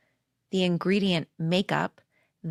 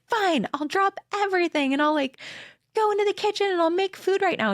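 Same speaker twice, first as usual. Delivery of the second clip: much higher, fast, huffy mock compliance; mad, sarcastic retort; 13 semitones higher than usual. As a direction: fire it off in a sarcastic huff. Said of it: "I got mad and I was like".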